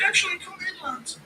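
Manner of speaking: high falsetto, crying tone settling; mock Ian. Mocking Ian, high whimpering welcome in. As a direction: switch to a wobbly high invitation. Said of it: "Impression of Ian defending".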